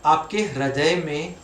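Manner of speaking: slow, measured meditation voice with long pauses; guided meditation. Acted. Teacher leading listeners to breathe in another's pain, slow guided meditation. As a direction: guide them phrase by phrase, leaving long silences.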